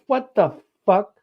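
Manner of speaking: high, slow disbelieving outburst; stunned at the answer; shocked. Retold or imitated. Hearing the Irish-sounding man is Canadian, shocked. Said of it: "I'm like".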